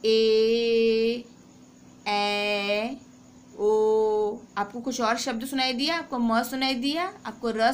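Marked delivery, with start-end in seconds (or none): 0.0-4.4 ए and ओ sustained loud and long; teacher demonstrating vowels aloud; held vowel demo. Shown as a demonstration. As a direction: sing out each vowel steadily. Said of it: "आप घर में बोल के देखिए".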